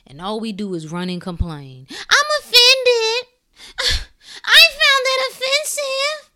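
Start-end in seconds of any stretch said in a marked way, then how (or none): 1.9-6.3 much higher, slow fake crying whine; whiny mock offense. Mocking people who cry they're offended, much higher. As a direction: mimic a weepy offended voice.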